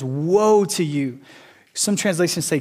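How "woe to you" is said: very slow, grave "Woe to you" with "Woe" stretched; solemn pronouncement. Acted. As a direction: pronounce the curse like scripture.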